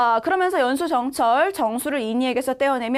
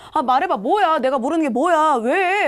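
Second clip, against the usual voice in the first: agitated demanding; 4 semitones higher, 4 dB louder than usual. Acted. Boy demanding the hidden truth from sister, agitated acted questioning. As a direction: pitch climbing through pressing questions.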